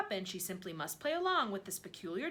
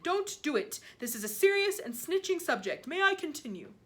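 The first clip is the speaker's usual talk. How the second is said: loud, higher scolding shout; giant shouting; 7 semitones higher, 7 dB louder than usual. A narrator's reading. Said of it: "the giant shouted".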